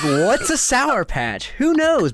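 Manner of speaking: climbing to a high who knows; puzzled candy kid; mock wonder. Sour patch kid wondering, rising puzzlement. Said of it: "And it's like".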